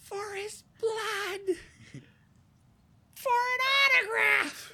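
very high, slow, loud squeal; squeaky outrage. Acted. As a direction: shriek it in disbelief. Goblin squealing in disbelief.

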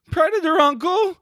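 very high, loud shouted catchphrase; mock Arnold catchphrase. Friend begging Arnold with a loud high catchphrase. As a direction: yell it like a plea.

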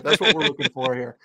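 ordinary talk

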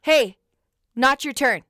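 loud sharp call across the park. Retold or imitated. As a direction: yell at the kid to back off. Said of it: "And I go".

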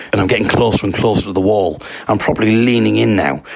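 plain reading voice

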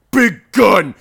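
loud, high exclamation; punchy mock-heroic shout; mock boast. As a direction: belt it out like a cartoon gun nut. Imitating the character grabbing a shotgun.

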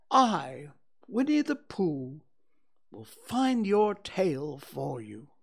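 slow, earnest little-bear vow; solemnly. Acted. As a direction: make a grand, sincere promise. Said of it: "he said solemnly".

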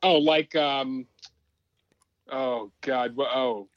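halting, sinking into exasperation; real memory blank. Real, not acted. Podcaster losing the name mid-example, halting and frustrated. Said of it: "I'm having an old moment".

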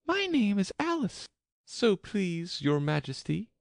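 high-pitched, polite Alice; courteous, lifted character voice. A narrator's reading. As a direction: answer royalty very politely in a high girl's voice. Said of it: "said Alice very politely".

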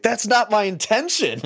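high, squeaky protest; exasperated protest. Retold or imitated. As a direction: squeak it out in mock frustration. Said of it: "I'm like".

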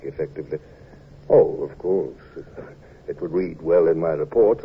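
low, reflective narration; story narration. A narrator's reading.

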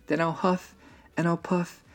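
high, mildly angry wolf threat; angry wolf cry. A narrator's reading. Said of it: "cried the angry old wolf".

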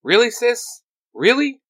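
high, loud disbelief; exasperated. Acted. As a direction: throw up your hands, really?. Exasperated ranger at sister.

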